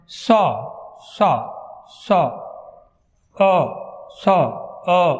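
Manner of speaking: سا سا سا آ, repeated slowly; vowel drill. Shown as a demonstration. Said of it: "یہ والی ساؤنڈ ہے". (سا، سا، آ، دہرا کر)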